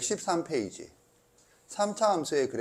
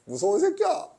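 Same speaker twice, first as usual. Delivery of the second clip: mock scared squeal; 3 dB louder than usual. Teasing student, high pitched.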